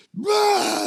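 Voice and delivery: sudden high loud bawl, a grown man breaking into sobs; bawling wail. Retold or imitated. Telling the cop his troubles, he bawls out loud. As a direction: burst out in a howling cry. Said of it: "And I started crying".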